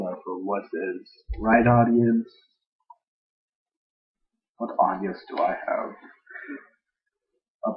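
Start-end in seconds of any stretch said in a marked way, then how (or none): none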